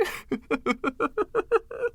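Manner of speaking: high, pleased giggle; contented giggle. Acted. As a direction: giggle with smug delight. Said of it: "He giggled contentedly".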